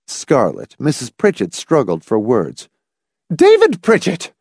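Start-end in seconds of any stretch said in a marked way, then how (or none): 3.3-4.3 high, louder mother's exclamation; outraged cry. A narrator's reading. Mother scolding her son, high outraged cry. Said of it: "she cried".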